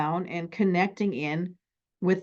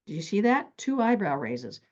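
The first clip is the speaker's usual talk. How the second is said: ordinary talk, nothing marked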